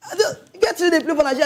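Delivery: high, laughing through the words; laughing, high pitched. Real, not acted. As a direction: laugh it out, voice jumping up. Laughing mid-rant.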